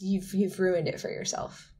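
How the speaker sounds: ordinary delivery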